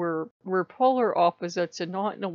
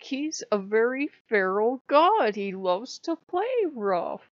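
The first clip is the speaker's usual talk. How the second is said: ordinary talk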